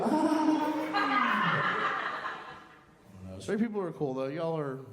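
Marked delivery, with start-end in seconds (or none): 0.1-2.6 high, drawn-out cackle; wild cackle. Acted. Comedian gloating over flaming joke, high cackle.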